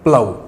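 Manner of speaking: प्लव said plau, no f sound; plough said right. Shown as a demonstration. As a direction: repeat प्लव, ending on the au vowel. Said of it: "प्लव होता है".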